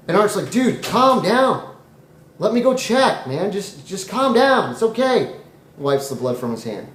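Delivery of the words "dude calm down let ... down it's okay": high, drawn-out pleading to calm down; stretched, raised-pitch reassurance; acted calming down. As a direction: soothe a panicking buddy in a high voice. Art tries to calm panicking Dave.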